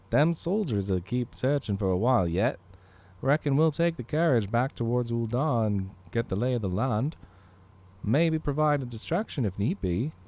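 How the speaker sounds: rough attempt at an Irish accent. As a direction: do your best Irish brogue. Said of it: "I don't even know how to do an Irish accent".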